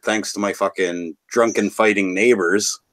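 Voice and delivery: ordinary talk, nothing marked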